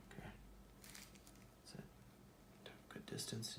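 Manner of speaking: soft whispered praise; whispered encouragement. Real, not acted. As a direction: whisper it under your breath. Quietly guiding student's stroke.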